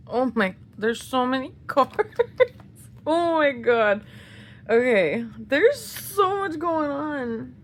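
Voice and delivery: loud, breathless gush, slowing on the last words; overwhelmed by it all; overwhelmed excitement. Real, not acted. Fan finding many photo cards gushes loudly, overwhelmed.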